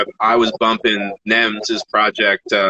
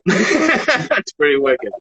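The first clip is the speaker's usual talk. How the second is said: higher, slow, laughing delivery; 8 semitones higher, much slower than usual. Real, not acted. Rapper admiring a friend's brand name, laughing. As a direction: let laughter stretch out the words.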